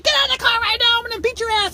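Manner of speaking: high, loud threatening shout; hammed-up high yelling mom; angry mother yelling. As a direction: scream a threat at your kid. Retells mother yelling at her child.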